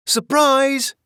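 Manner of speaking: high, loud surprise yell. A narrator's reading. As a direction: shout it out gleefully. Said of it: "yelled Duchess and the kittens".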